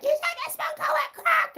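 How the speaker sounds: outraged mother squeaking; shrill mom. Retold or imitated. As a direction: mimic mom scolding. Mom yelling accusation.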